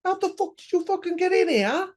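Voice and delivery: shrieks high and loud, mock outraged; a hammed-up squeal of disbelief; shocked high yell. Family finds Joe Gacy in their trunk, hammed-up high shriek. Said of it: "And they're like".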